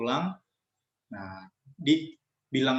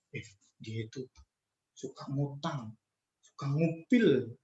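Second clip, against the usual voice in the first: softer, secretive backbiting murmur; gossipy hush; 5 dB quieter than usual. Acted. A student gossiping about a friend who just left, hushed. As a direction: lean in and dish the dirt quietly.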